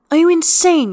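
high, loud exasperated outburst; exasperated shout. Acted. Astarion scolds Gale for standing in the rain, high loud outburst. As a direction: yell it at him in disbelief.

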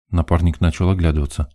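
usual speaking voice

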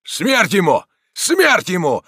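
high, loud battle cry; mob's shout. A narrator's reading. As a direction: yell like an enraged attacker. Angry attackers answer in chorus. (яростный крик толпы)

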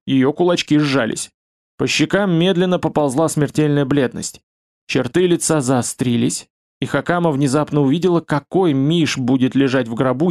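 ordinary delivery

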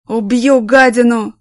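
loud slow menacing yell; drunken threat. Acted. Drunk man bellowing threats at his absent wife, loud.

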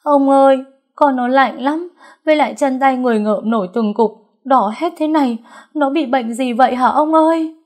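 high, loud frantic mother's plea; distraught wailing. Acted. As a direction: wail to your husband about the sick child. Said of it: "một người phụ nữ gào khóc". (gào khóc thảm thiết, giọng cao và to)

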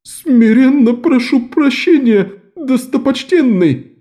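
high, slow, tearful pleading; begging in fear. A narrator's reading. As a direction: grovel for forgiveness. Terrified merchant begs forgiveness.

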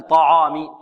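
"طعام" with ع thickened, its vowel backed toward "aw"; wrongly heavy ع. Shown as a demonstration. Teacher shows students the mistake, over-heavy ع in "طعام". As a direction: darken the ع in "طعام" like the ط. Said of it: "العين ليست من حروف التفخيم".

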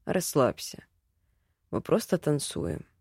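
low, soft voice of a calm dance partner; reassuring male elf. A narrator's reading. Elf relaxing his nervous dance partner, low and soothing.

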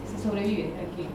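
usual speaking voice

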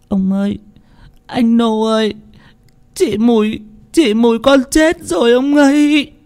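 put-on a girl breaking down as she tells the news; choking up. A narrator's reading. Servant girl telling Ông Phú of Mùi's death, choked with tears. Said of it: "Con Tân nghẹn ngào".

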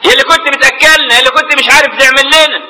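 high, stretched mourning cries; keen for the dead, acted not real; imitated wailing lament. Sister mourns her dead brother, imitated wailing. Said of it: "أخته تقول له".

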